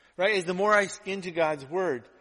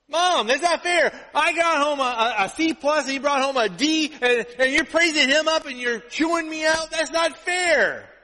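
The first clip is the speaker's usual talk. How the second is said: whiny complaint; 11 semitones higher, 7 dB louder than usual. Retold or imitated. Son complaining to mom about unequal treatment, mock outraged whining. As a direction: wail it like a wronged child, hammed up. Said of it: "so I kinda complained".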